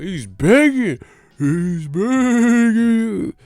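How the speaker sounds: high, stretched-out mock wail; ghost impression. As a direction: draw it out like a spooky ghost. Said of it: "why are you talking like a ghost".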